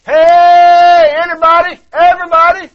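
loud, high shout to anyone; mock calling out. Preacher acting a wrong call from shore, loud shout. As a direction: call out wide to the whole lake. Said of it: "You didn't say".

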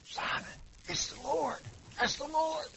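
hushed nudge. Acted. John nudging Peter in boat.

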